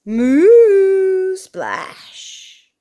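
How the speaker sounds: high, loud, long moo ending in a splash; mooing dive. Acted. Cow plunges into the pond, long loud moo. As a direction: moo like a falling cow, then breathe the splash.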